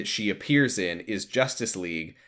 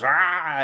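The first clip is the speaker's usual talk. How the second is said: mock power-up cry; 4 semitones higher than usual. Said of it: "and he goes".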